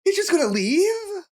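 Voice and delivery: shrill hammed-up whining cry; bad acting. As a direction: overdo a teen begging cops. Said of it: "It's pretty good, right?".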